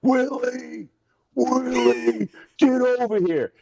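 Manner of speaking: high-pitched mock holler. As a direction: imitate a coach hollering across the sideline, no real volume. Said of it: "I can hear B C screaming".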